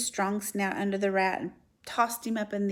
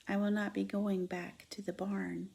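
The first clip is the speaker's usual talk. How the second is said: very soft, faint and weary; barely audible; 8 dB quieter than usual. Acted. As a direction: speak so low he can hardly hear. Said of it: "in a voice so low Wilbur could hardly hear".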